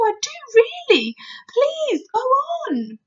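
high, loud, urgent begging; pleading cry. A narrator's reading. Girl begs the giant to continue, high pleading. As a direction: beg him earnestly in a high voice. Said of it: "Sophie cried".

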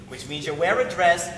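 ordinary delivery

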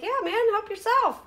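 high breezy offer; lightly imitated cheerful offer; generous offer. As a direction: play it generous and easy. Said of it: "I was like".

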